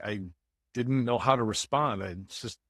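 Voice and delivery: ordinary delivery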